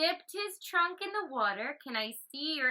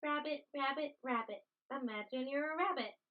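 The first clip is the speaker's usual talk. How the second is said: normal talking voice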